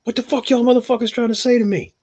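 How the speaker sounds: shrill mock outrage from the coffee lady; angry lady impression. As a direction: squawk it up high like the offended cashier. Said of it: "The lady's like".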